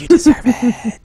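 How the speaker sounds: slow, loud, theatrical; dramatic loud declaration. Acted. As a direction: bellow it like a movie hero. Acted villain retort.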